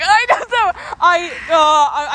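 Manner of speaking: loud, high flustered outburst; flustered yelp. Real, not acted. Friend panicking about spoiling the show, loudly flustered. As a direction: catch yourself before the spoiler.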